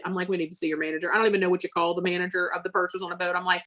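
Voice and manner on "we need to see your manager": ordinary talk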